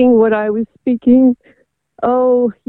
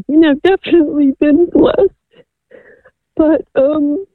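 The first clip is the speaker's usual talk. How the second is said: tearful; 5 semitones higher than usual. Real, not acted. Caller telling of her adopted children after infertility, tearful. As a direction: voice high and choked with real crying. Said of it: "we can obviously hear the emotion in your voice and the pain".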